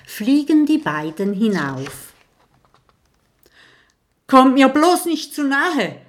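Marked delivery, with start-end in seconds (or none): 4.3-6.0 shaky moan. A narrator's reading. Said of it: "stöhnt Clementia mit zittriger Stimme".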